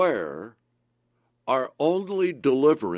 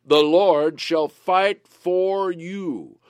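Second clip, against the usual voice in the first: slow, louder and higher declaration; slow proclamation; 4 semitones higher, 5 dB louder, much slower than usual. Acted. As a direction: thunder it out slowly. Climactic scripture proclamation.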